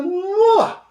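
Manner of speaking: sharp high mineiro "Nó!"; Minas exclamation. Retold or imitated. Preacher imitating a mineiro, very high surprised "Nó!". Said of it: "Aqui em Minas".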